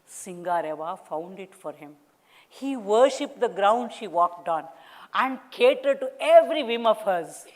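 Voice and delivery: ordinary delivery, nothing marked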